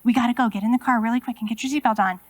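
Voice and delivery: put-on fast, pushy hurrying the kids along; a touch of stressed mom rushing the kids into the car. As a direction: rattle it off like a mom running late. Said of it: "If they feel that stress from me".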